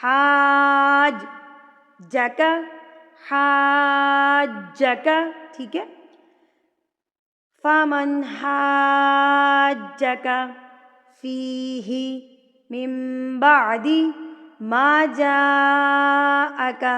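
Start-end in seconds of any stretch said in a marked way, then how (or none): 0.0-5.3 حاج stretched, then جا کا, jeem heard twice; doubled jeem. Shown as a demonstration. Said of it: "دو دفعہ جیم کی آواز آئے گی". 7.6-16.8 slow, drawn-out chanted recitation; Quranic recitation. Shown as a demonstration. Recites the verse.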